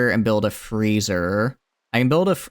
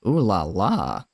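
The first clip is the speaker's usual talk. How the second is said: mock French; slower than usual. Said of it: "have you guys heard a French accent?".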